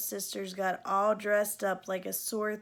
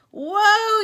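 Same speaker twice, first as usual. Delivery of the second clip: high, much louder shout; yell; 10 semitones higher, 11 dB louder than usual. A narrator's reading. Joe yelling as Cindy runs off. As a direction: yell it after her. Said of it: "yelled Joe".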